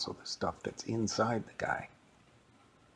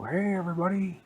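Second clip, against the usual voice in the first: high, loud cartoon mascot greeting; big cheery mascot hello; cheerful mascot; 9 semitones higher, 4 dB louder than usual. Mascot bursts through the wall.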